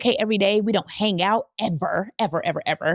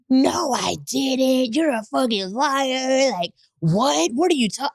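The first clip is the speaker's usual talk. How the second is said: slow, drawn-out, higher mock protest; imitated incredulous denial; 5 semitones higher, slower than usual. Woman imitating her friend denying the kiss, drawn-out mock protest. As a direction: stretch every word in mock disbelief.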